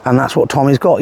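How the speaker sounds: normal talking voice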